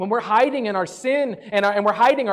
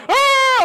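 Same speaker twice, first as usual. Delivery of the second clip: startled yelp, much higher and louder; startled scream; 4 dB louder than usual. Retold or imitated. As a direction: jump in fright at the voice. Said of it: "I'm like".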